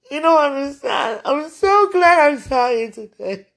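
high, loud, emphatic gladness; overjoyed insistence. Real, not acted. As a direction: burst out with how glad you are. Insisting how glad she is.

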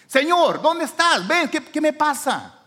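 loud, high cry to God; desperate calling out. Acted. Preacher as Adam seeking God, urgent high call.

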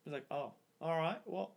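halting put-on British-sounding accent; awkward exit in a put-on accent; changed character accent. Leaving the girl in a put-on British-sounding accent. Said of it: "the whole character changes".

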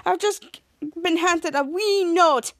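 slow mock Scottish accent; drawn-out imitated Scottish. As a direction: say it slowly like a Scottish newscaster.

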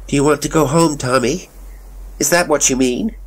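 high-pitched motherly questioning; concerned mother. A narrator's reading. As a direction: question a child as his worried mother. Mother questions her frightened child.

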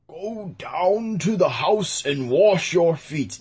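a hint of a slow commanding king; slow, lightly regal command; kingly voice. Trying out King David's voice, slow and lordly. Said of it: "What kind of voice should David have? He's a king".

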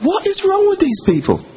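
high, exasperated voice of God. Retold or imitated. God looking down at quarrelling people, high and exasperated. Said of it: "God's looking down and thinking".

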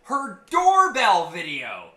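high, loud, drawn-out exasperation; indignant rant. Real, not acted. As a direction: hammer it out in loud disbelief. Indignant rant about neighbor's doorbell video.

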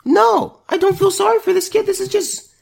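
high-pitched disbelief rushing out; incredulous rant. Real, not acted. Ranting at the burned kid's choices, high fast incredulity.

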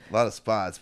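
normal talking voice

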